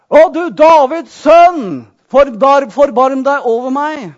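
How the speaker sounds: slow, loud retold cry for mercy; calling out. Retelling blind Bartimeus calling to Jesus, a slow loud cry. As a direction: call it out long and loud like the blind beggar. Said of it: "begynner han å rope".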